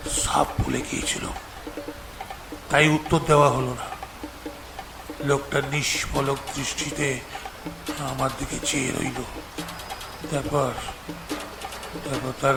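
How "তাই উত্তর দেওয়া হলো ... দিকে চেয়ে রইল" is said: ordinary delivery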